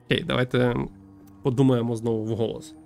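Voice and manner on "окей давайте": normal talking voice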